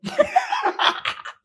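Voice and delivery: high airy burst of laughter; laughing. Real, not acted. Laughing at own funny story. (ऊँची, साँस भरी हँसी)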